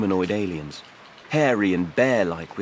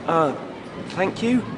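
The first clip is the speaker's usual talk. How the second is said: put-on much higher young voice, hesitant; nervous youth; 10 semitones higher than usual. A narrator's reading. Wim thanks his rescuer, high and unsure. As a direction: thank him warily. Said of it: "said the youth".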